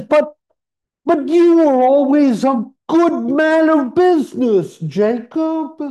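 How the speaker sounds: put-on high, loud stammering old miser; pleading Scrooge. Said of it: "Scrooge says".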